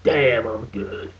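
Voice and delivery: low, slow, smug robot voice; mock robot hero. Mocking an Autobot line for a rant about the movie, low and slow. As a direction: drop deep and drag it out, full of himself. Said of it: "And then he goes like".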